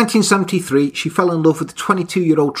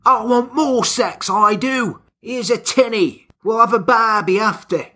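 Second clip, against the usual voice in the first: higher, hammed-up Australian; Australian ocker; 4 semitones higher than usual. Australian slang role play.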